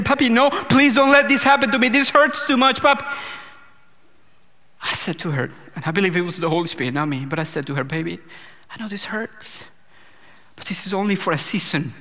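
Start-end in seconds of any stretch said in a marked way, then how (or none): 0.0-3.1 high, louder mimicked child begging; retold child's pleading scream. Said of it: "my daughter was screaming to me and saying". 7.8-11.9 much softer, tender reassurance; gentle comfort. Retold or imitated. Dad comforting his crying daughter.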